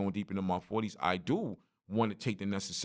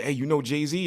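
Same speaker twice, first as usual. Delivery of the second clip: higher-pitched imitation of a nosy stranger; eager stranger; 6 semitones higher, 3 dB louder than usual. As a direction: mimic a stranger's eager question. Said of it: "He was like".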